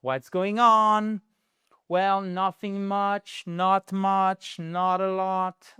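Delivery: slow "What's going on" demo; slow model phrase. Shown as a demonstration. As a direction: lay it out slowly for learners. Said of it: "teacher models phrase slowly".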